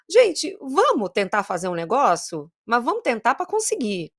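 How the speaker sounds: ordinary talk, nothing marked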